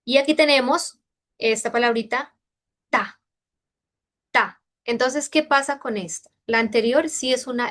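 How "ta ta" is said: ta between D and T, very little air; unaspirated T. Shown as a demonstration. Teacher models new word, ta without air. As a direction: say ta softened toward a D, no puff. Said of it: "está sin aire".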